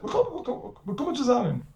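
high, mock-cheerful reassurance; a lightly hammed-up carefree congregant; mocking the complacent guy. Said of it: "The guy says".

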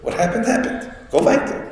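high, pushed-up urging to move on. Retold or imitated. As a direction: philosopher urging the weeping prophet onward, pitched high. Philosopher urging Yirmiyahu to move on.

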